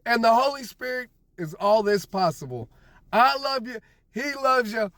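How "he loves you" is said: normal talking voice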